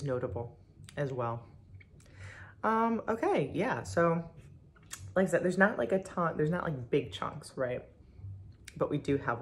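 speaking between bites; talking while eating. Real, not acted. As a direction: mouth partly full. Seen 1: spoon of ice cream at lips, mouth closed between phrases.

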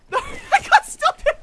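high, loud, slow laughing cry; laughing shout. Real, not acted. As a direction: shout it while cracking up. Laughing shout during a frantic fight.

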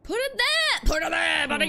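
real shout, high and loud; frustrated shout. Real, not acted. Yelling at the game controls in frustration. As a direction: yell at the game to obey.

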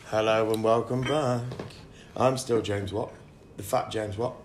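slow, mock TV presenter welcome back; mock announcer. Podcast host back from a pretend commercial break, mock announcer voice. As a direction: play the host returning from the break, slowly.

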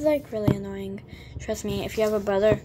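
ordinary talk, nothing marked

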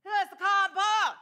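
loud, high Boston shop-owner holler; shouted imitation of a Boston shop owner; quick yell. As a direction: yell it out fast with dropped R's. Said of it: "the owner of the shop quickly yelled".

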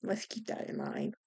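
a sly under-breath whisper; hushed aside to the listeners. Real, not acted. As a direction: drop it to a whisper like a secret.

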